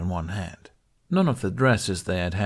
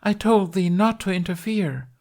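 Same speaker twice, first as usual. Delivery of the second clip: high, loud stage scolding; crying out; 11 semitones higher, 5 dB louder than usual. A narrator's reading. Knight rebukes the lion for helping, crying out high. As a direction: scold theatrically in a raised voice. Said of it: "Tooth cried".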